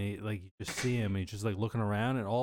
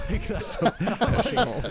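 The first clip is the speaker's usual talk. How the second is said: laughing; 6 semitones higher, 6 dB louder than usual. Real, not acted. Laughing at own fear.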